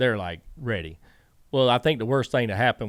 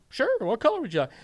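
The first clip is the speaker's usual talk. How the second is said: higher, mock-polite Pops voice; imitating Pops; 14 semitones higher, faster than usual. Pops offering Teresa tape, mock-polite and high. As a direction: play Pops being overly helpful.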